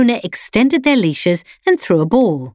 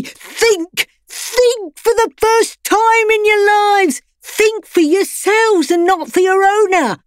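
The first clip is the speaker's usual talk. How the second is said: much higher, louder rousing speech; rallying rebel dog; 14 semitones higher, 4 dB louder, slower than usual. A narrator's reading. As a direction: whip up the crowd like a revolutionary. Freddy rallies the pets.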